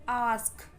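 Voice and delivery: "आस्क" opening on a long aah; long A sound. Shown as a demonstration. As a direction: stretch the first vowel of "आस्क". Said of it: "long sound है ये".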